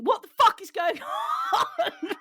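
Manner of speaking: jolted awake, yelling in panic; mock startled yell. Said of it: "James wakes up going".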